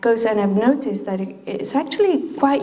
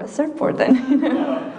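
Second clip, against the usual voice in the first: laughing; 5 semitones higher than usual. Real, not acted. Joking about surfing the quake.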